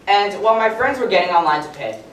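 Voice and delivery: ordinary delivery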